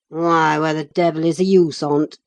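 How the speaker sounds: loud surly growl. Acted. Hareton to his mocking companions, loud surly growl. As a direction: snarl it back, loud. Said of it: "growled Hareton".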